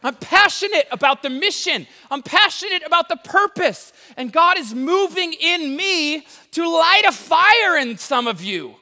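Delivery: loud, high passionate shout; outdoor voice indoors. Real, not acted. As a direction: preach it at full volume. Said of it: "why are you yelling at us?".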